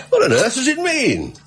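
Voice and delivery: high laughing question; amused disbelief. Acted. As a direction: laugh through it, baffled and tickled. Wilson laughs at the odd advertisement.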